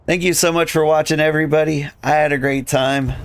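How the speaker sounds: cheerful upbeat goodbye; excited sign-off. Real, not acted.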